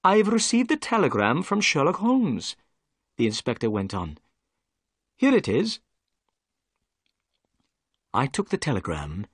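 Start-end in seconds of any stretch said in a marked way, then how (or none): 0.0-2.6 lightly voiced police inspector, a little higher; a hint of a humbled inspector, polite; politely greeting the doctor, lightly voiced; Inspector Jones. A narrator's reading. 5.2-5.8 lightly voiced police inspector, a little higher; a hint of a humbled inspector, polite; politely greeting the doctor, lightly voiced; Inspector Jones. A narrator's reading.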